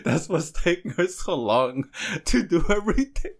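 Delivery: high voice breaking into laughter; squeaking up with amusement at himself; real amusement. Real, not acted. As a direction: laugh through the confession. Laughing again at his own mistake.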